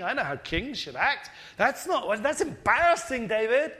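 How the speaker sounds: rising to a high, loud scold; a king's daughter shamed, voice climbing high; disdainful wife. Retold or imitated. Wife Michal scolding David for dancing, high and loud.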